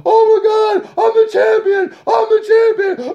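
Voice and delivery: high shouting victory squeal; mock overjoyed champion. Mocking Fury as he yells about winning the belt, high shouting joy. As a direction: crow it out like a giddy new champ.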